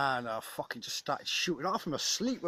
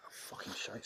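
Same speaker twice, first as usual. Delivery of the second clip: whispered curse; 4 semitones lower, 12 dB quieter than usual. Real, not acted. Muttered aside mid-talk.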